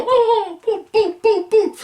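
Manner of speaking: high playful boos at the movie; mock booing. Jeering the Mario movie.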